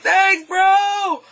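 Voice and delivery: high-pitched, drawn-out cheer; hammed-up thankful call; mock grateful shout. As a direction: yell after a departing hero. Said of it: "you're kinda like".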